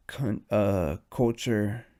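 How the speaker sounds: usual speaking voice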